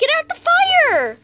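high, loud, drawn-out yell; urgent shout. Real, not acted. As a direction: yell the warning, stretching it out. Warning a burning player.

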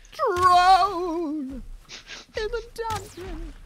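very high shriek, then slow and strained; high squealing theatrical warning; mock panicked cry. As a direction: shriek a hammed-up alarm, then draw it out. Echoes the film alarm cry.

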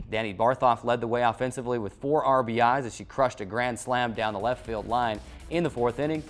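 ordinary delivery

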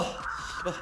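whispered curse, barely voiced; cursing under breath. Real, not acted. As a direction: hiss it under your breath. Curses alone at the map.

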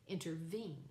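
Intervene with the weight on VENE; stress on third syllable. Shown as a demonstration. Teacher demonstrating an anapestic foot, Intervene stressed on the third syllable. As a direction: lean on the last syllable of Intervene. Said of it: "with the accent on the third syllable".

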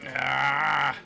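long, loud open yell; loud scream. Real, not acted. Player reacting to the game, loud scream. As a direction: scream out on one held vowel.

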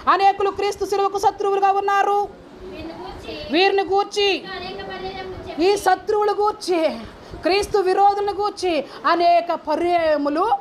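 high, sustained preaching tone; scripture proclamation. Acted. Preacher reading out a warning about enemies of the cross, high proclamation. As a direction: proclaim the verse high and steady.

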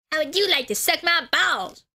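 a rough try at a bratty cartoon kid; Cartman impression. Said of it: "I used to be able to do Cartman".

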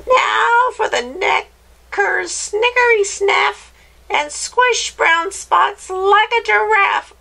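high-pitched witch chanting; screechy witch. Acted. Witch giving the dog a giraffe neck, screechy voice. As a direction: screech the spell with relish. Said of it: "in her high, screechy voice".

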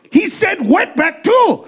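high, slow shocked shout; hammed-up shocked yell; mock outrage. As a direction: gasp it out in outrage.

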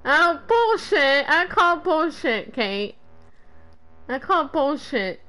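ordinary talk, nothing marked

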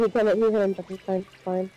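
ordinary delivery

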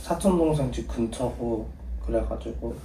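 느리고 점점 작게 둘러대기; 오빠에게 조용히 변명하듯; 내숭 전화 목소리. Real, not acted. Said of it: "누나 목소리가 왜 바뀌었어?". (얌전하게 말끝을 흐리며)

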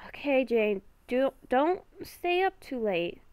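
ordinary talk

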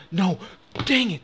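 real frustrated cry; dismayed shout. Real, not acted. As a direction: shout no as the spin misses. Losing roulette spin.

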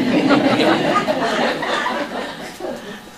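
breathy high laughter; delighted high laugh. Real, not acted. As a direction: burst into laughing. Laughs after angel line.

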